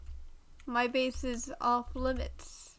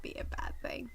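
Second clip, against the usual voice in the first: soft whispered musing; whispering; 6 dB quieter than usual. Real, not acted. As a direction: whisper it to yourself. Musing quietly.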